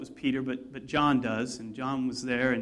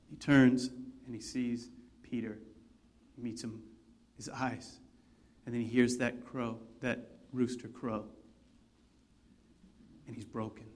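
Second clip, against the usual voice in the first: hushed; 5 semitones lower, 8 dB quieter than usual. A narrator's reading. Sombre climax of denial.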